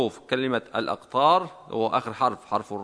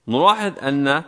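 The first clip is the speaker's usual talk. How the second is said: ordinary delivery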